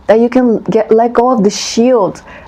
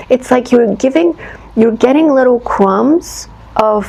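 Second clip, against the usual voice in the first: ordinary delivery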